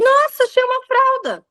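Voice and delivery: very high, playful make-believe discovery; sing-song mock surprise. Mom restarting the scene for her daughter, sing-song mock surprise. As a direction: pretend cheerfully that you just found it. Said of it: "eu volto cantando e tudo".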